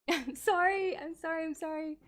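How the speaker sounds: laughing apology. Real, not acted. Laughing apology after mocking.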